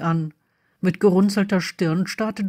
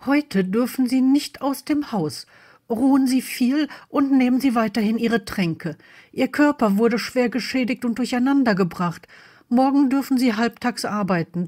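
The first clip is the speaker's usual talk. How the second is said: higher, firm healer voice; higher-pitched professional admonishing; cautioning healer; 4 semitones higher than usual. Acted. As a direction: instruct a patient with care.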